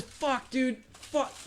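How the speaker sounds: high, held-back pained cursing; acted pain. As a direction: curse softly and high as if pepper-sprayed. Sprayed victim stumbles and curses.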